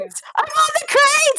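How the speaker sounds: very high, drawn-out squeal; incredulous protest. Real, not acted. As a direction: protest slowly at the top of the voice. Player protesting game master's attack.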